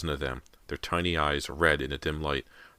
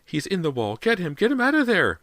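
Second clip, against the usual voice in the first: very high, loud scream; 8 dB louder than usual. A narrator's reading. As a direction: scream it out in terror. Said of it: "Missus Fraser shrieked".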